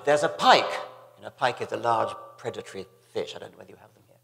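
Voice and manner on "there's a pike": louder, higher mock shout of alarm; retold shout. Storyteller recalls a swimmer's warning about a pike, mock shout. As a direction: call out a warning, raised voice. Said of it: "somebody shouted".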